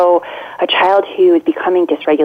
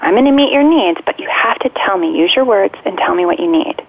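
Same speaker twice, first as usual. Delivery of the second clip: put-on higher, lilting and playful to an upset child; 6 semitones higher than usual. Shown as a demonstration. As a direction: coax a mad kid gently with a light, bouncy tone. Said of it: "you wanna be playful and say".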